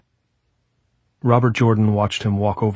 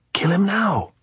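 urging; 12 semitones higher than usual. A narrator's reading. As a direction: urge it in a raised pitch.

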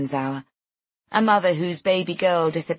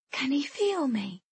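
put-on high, soft, slow little-boy voice; small son's hushed, high question; small child; 8 semitones higher, 5 dB quieter than usual. A narrator's reading. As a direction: ask softly like a curious child. Small son asks about baby.

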